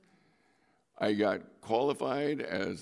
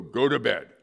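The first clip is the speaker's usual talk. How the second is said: loud mock bedtime command; stern parent order; 3 semitones higher, 9 dB louder than usual. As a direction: send the kids off to bed, loudly. Parent ordering kids to bed.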